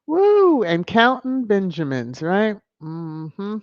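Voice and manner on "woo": high whooping cheer, much louder; excited woo. Real, not acted. Streamer whooping at the chat's joke, excited. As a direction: let out a delighted whoop.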